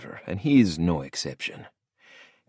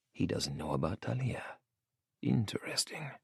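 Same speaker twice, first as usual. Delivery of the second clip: soft unvoiced whisper; 12 dB quieter than usual. A narrator's reading. Santi muses privately as his father talks, whispered. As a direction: breathe the word to yourself.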